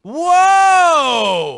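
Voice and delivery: loud surprised whoa; startled shout. Acted. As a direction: jump back in alarm. Alarmed shout.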